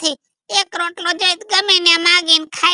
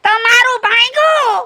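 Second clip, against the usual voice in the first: wailing shout of grief; crying out; 3 semitones higher than usual. Acted. Old man wailing to neighbour about runaway wife. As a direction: cry it out as his heart breaks.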